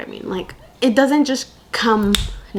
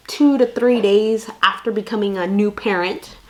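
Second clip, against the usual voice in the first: ordinary delivery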